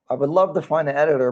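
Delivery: ordinary delivery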